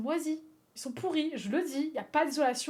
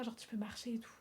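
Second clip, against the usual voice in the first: whisper; 8 dB quieter than usual. Real, not acted. Whispered aside.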